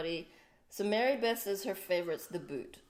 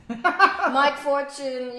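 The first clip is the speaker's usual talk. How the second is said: calling out; 7 semitones higher, 12 dB louder, much slower than usual. Real, not acted. Calling out to a viewer.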